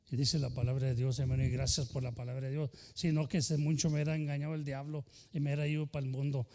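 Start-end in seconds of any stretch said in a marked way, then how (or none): none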